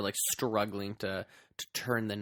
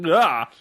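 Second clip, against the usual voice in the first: short, loud startled "ah"; mock yelp of surprise; surprised cry; 5 semitones higher, 12 dB louder than usual. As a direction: burst out higher, as if caught off guard. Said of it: "he's so surprised that Lara Croft".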